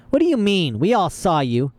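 incredulous villain losing patience; angry disbelief. A narrator's reading. Villain challenged, growing angry.

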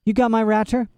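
put-on high, lost little boy; a dazed small boy asking. A narrator's reading. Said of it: "said the boy".

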